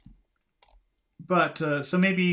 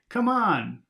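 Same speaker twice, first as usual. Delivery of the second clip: impatient; 10 semitones higher, 7 dB louder than usual. Shown as a demonstration. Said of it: "It's a little bit unpa-, impatient".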